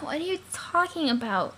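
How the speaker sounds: ordinary talk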